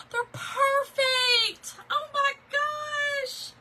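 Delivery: high, drawn-out squeal of delight. Real, not acted. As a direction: gush over a gift, stretching every word high. Delighted reaction to the gift.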